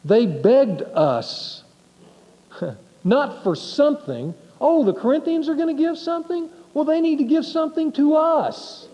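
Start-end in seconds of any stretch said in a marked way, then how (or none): 4.6-8.9 much higher, whiny grasping voice; mock a greedy church demanding its share; sarcastic high whine of entitlement; greedy mock beggar. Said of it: "No, they didn't beg that way".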